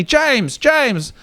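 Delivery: high calling out of a name; an old neighbour hollering up; mock yell. Jeff calling James from below, high mock yell. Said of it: "he yells out at me".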